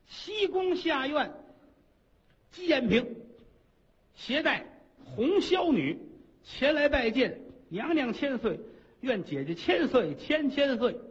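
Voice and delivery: bow and coo the greeting sweetly; simpering consort. Acted. Consort greeting the queen, high and sugary.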